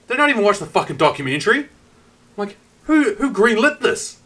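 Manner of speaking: high-pitched, incredulous complaint; exasperated disbelief. Retold or imitated. Stunned viewer questioning who approved the documentary, incredulous. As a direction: demand an answer in outraged disbelief.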